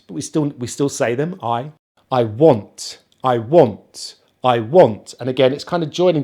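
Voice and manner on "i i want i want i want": "I want" joined, WANT stronger; light "I" running into emphatic want; stress on WANT. Shown as a demonstration. Teacher drilling pronoun and verb, stress on want. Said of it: "putting the stress on the want".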